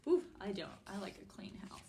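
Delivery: plain reading voice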